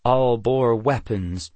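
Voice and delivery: ordinary delivery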